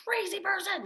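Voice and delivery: high angry shout; screaming. Retold or imitated. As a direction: scream it to shut the voice up. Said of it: "screaming at it".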